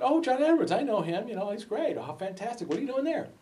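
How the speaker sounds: high, gushing phone chatter; imitating a thrilled mother; excited mom. Mom's delighted reply on the phone.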